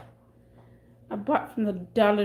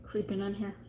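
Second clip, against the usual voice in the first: whispering; 3 semitones lower, 8 dB quieter than usual. Pretend. Sneaking back to camera.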